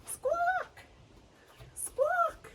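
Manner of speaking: very high squawking parrot. Shown as a demonstration. Teacher squawking like a parrot for the kids. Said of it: "Let's fly like a parrot".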